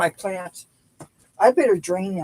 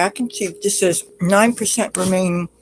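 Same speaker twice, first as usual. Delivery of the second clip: usual speaking voice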